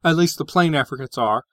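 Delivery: normal talking voice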